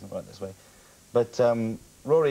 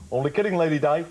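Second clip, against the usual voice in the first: higher, punchy Scouse comic; Jimmy Tarbuck; 5 semitones higher, 4 dB louder than usual. Acted. Comic recalling his Spitting Image voice, Tarbuck impression. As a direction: toss out a cheeky catchphrase in Scouse. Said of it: "yeah, actually, Jimmy Tarbuck".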